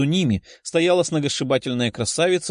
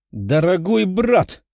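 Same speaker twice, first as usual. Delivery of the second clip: slow, higher-pitched cry of concern; drawn-out, dramatic exclamation; alarmed exclamation; 4 semitones higher, slower than usual. A narrator's reading. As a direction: cry out, rushing to an injured brother. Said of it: "воскликнула красноволосая и кинулась к парню".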